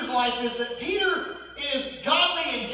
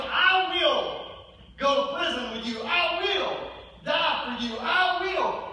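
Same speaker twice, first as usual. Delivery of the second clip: loud, higher proud vow; proud Peter; 7 semitones higher, 3 dB louder, slower than usual. Retold or imitated. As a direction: hammer each "I will" like a braggart. Voicing Peter's proud boast.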